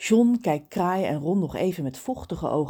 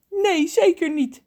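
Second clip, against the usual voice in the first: higher, slow tearful sob; sobbing; 11 semitones higher, 6 dB louder than usual. Acted. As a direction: choke it out through tears. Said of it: "snikt Ron".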